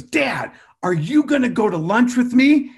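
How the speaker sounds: lightly voiced kindergartner pleading; little son. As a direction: ask like an eager little boy. Son asking, voiced higher.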